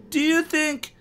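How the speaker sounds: put-on high tentative boy's voice; high-pitched nervous kid; Evan's voice. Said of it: "Evan started".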